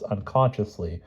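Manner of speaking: plain reading voice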